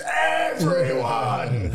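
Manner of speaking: long, slow, loud bellow of "Everyone!"; Gary Oldman yell. Retold or imitated. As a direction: roar it like the corrupt cop in Leon. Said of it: "He goes".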